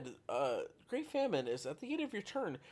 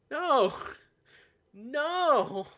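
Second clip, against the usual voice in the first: loud, high repeated no; shouting no; 12 semitones higher, 8 dB louder, much slower than usual. Real, not acted. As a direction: yell it, horrified. Reacting to a bad card.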